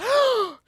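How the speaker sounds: sharp high shocked gasp; mock surprised high gasp. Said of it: "he gasped, bro".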